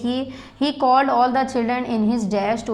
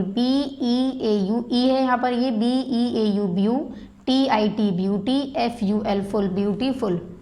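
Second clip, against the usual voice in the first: slow spelling out; slower than usual. Shown as a demonstration. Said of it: "मैं spelling भी इसमें dictate करती जा रही हूँ".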